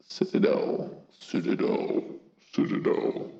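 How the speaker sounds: whispered spooky try-out; eerie, hushed voice test; scary voice. Acted. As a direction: whisper it like a creepy warning. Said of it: "you want a scary voice".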